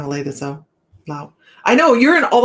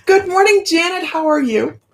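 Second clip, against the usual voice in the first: sing-song hello; 11 semitones higher, 4 dB louder than usual. Real, not acted. Greeting a viewer sing-song.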